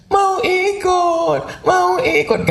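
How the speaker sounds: put-on very high, slow whiny child; high slow whimpering child voice; crying toddler. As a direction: beg to go along like a sobbing toddler. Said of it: "Anak ibu nangis".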